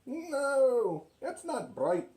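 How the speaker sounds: ordinary delivery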